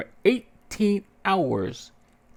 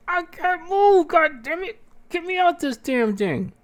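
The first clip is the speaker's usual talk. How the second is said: desperate demand; 5 dB louder than usual. Retold or imitated. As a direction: plead and curse to be untied. Strapped down, demanding release.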